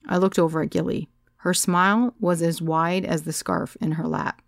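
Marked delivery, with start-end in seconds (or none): none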